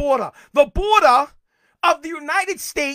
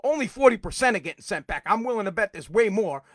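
ordinary talk, nothing marked

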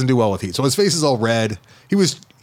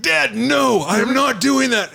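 much higher, slowing protest; mock furious kid; 12 semitones higher, much slower than usual. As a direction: imitate the furious son refusing. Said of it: "he becomes fucking furious".

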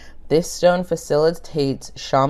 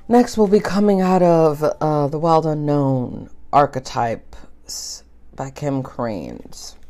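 ASMR whisper; slower than usual. Pretend. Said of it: "A S M R".

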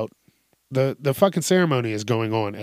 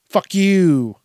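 loud, high mock defiance; acted-out taunting yell; defiant shout; 6 semitones higher, 8 dB louder than usual. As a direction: throw it in their face, loud and drawn out. Acting out a defiant refusal.

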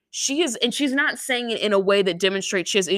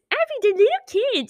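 very high, exaggerated mocking mimicry; much faster than usual. Retelling how the coach mimicked the mom, mocking. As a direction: parrot the plea in a silly squeal. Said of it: "She goes literally like this".

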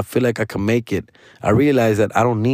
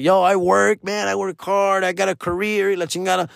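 mock-defiant, pitched up higher; defiant retort; 7 semitones higher than usual. Ex answering his complaint about the nanny, defiantly. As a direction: imitate her firing back that she works. Ex insisting she works hard.

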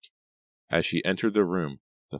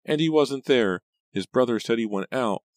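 high, louder upset cousin voice; upset Pam; 7 semitones higher, 5 dB louder than usual. A narrator's reading. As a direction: complain in a high, thin voice.

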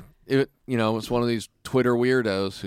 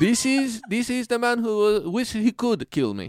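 high, laughing broken-English voice; mock foreign accent; 12 semitones higher than usual. Foreign guy complains about the man who wants him dead, in a mock accent. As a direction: keep the foreign guy's voice going.

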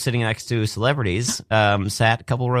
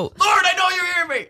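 shouting out. Pretend. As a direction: yell for her to hear you. Calling out to [name].

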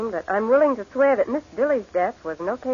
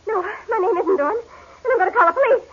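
high, tearful, panicked denial; crying in fear; 11 semitones higher than usual. Acted. Captive woman cries and threatens to call the police. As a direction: sob out the words in terror.

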